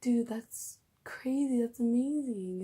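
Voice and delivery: loud, high, drawn-out amazement; freaking out. Retold or imitated. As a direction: retell being thrilled, stretching the words loud and high. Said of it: "freaking out at work".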